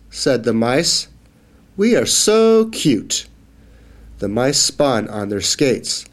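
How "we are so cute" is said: proud squeal. Acted. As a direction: squeal it like proud little mice. Proud mice voice.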